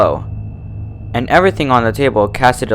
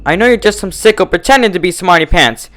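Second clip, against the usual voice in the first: high, loud raging threat; furious threat; 8 semitones higher, 5 dB louder than usual. A narrator's reading. Twilight threatens the impostor, furious. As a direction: threaten to tear it apart.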